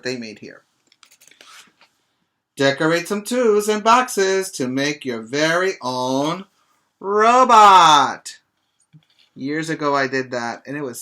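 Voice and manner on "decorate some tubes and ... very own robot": loud, lively storybook reading, robot called out high; reading to children. Acted. Teacher reading a picture book to her class, robot exclaimed. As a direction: build up and land robot big for the kids.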